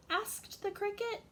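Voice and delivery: ordinary delivery, nothing marked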